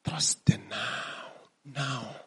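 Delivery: slow hushed mantra; slow whisper. Acted. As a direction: whisper it slowly, barely voiced. Meditative teaching, hushed key phrase.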